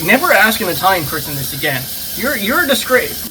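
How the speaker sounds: hammed-up furious shout; mock outrage. Imitating an Italian friend scolding him over pasta, mock outrage. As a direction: yell a scolding in exaggerated disgust.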